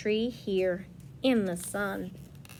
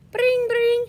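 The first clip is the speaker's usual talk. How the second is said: phone ring; 12 semitones higher, 7 dB louder than usual. Shown as a demonstration. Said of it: "imitating phone ring".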